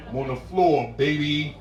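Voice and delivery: ordinary delivery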